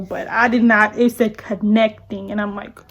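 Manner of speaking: ordinary delivery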